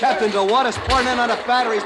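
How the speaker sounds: loud urgent report; urgent alarm. Acted. Officer warning the captain about water on the batteries, urgent. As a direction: shout the danger to the captain.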